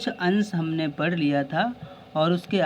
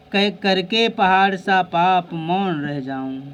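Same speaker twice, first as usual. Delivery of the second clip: poem recitation; 4 dB louder, slower than usual. Acted.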